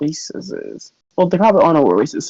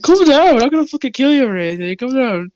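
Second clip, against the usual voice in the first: lightly voiced guard; 7 semitones higher than usual. GM as Elijah soothing captive.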